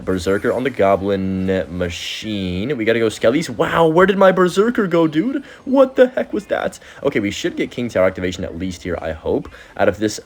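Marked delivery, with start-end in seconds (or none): 3.5-6.8 high, loud cry of disbelief; can't believe what just happened, way up high; stunned yelp; shocked disbelief. Real, not acted. His Berserker suddenly vanishes.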